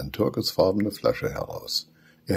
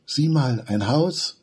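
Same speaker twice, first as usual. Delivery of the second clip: higher, louder pointing out; excited discovery; 8 semitones higher, 4 dB louder, slower than usual. A narrator's reading. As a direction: spot the house with delight. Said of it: "sagt Benny". (aufgeregt entdeckend)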